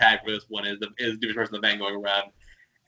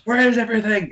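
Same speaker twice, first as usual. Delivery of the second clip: much higher, louder bewildered quote; baffled cry; 11 semitones higher, 3 dB louder than usual. Retold or imitated. Podcaster quoting the baffled station manager, high bewildered cry. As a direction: throw it up high like the character can't believe it.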